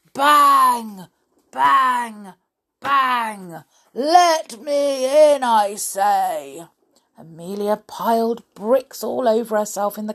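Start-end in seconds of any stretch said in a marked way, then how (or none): 0.1-3.6 high, loud, drawn-out bangs; imitated banging. A narrator's reading. 3.9-6.7 slow, loud, drawn-out order; bogeyman's demand. Acted. Bogeyman shouting through the door.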